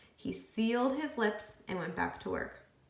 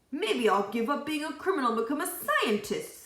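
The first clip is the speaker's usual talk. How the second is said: reformed robber; 6 semitones higher, 4 dB louder than usual. A narrator's reading. Robber voiced in story.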